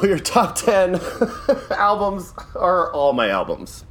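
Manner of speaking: chuckling at own joke; laughing. Real, not acted. Joking about own taste.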